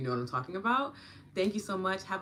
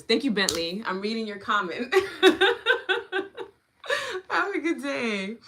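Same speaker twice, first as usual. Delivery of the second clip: louder, high laughing thanks; 7 semitones higher, 11 dB louder than usual. Real, not acted. Host laughing while reading a viewer's comment aloud. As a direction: bubble over at a funny comment.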